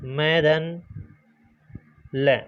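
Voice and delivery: spelling out the sound parts; one sound at a time, like a teacher; sound-by-sound split. Shown as a demonstration. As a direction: break the syllable into its parts slowly. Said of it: "इस प्रकार से इसको टुकड़े कर सकते हैं".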